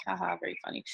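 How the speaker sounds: half-hearted haha, humouring a stale joke; token laugh. Retold or imitated. Vegan brushing off a friend's pizza joke, token laugh. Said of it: "laugh and are like".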